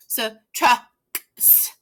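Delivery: "trucks" with a short uh sound before the K; teacher models "trucks" for the class, clear short U. Shown as a demonstration. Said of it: "Do you hear that?".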